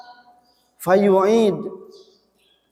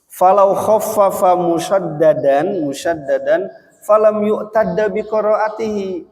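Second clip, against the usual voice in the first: reciting Arabic; 5 semitones higher than usual. Shown as a demonstration. Teacher quoting the Arabic rule in recitation. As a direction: intone it like a quoted text. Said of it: "quoting Arabic rule".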